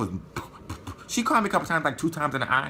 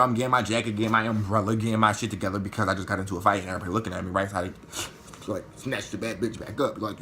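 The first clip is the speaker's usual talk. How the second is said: normal talking voice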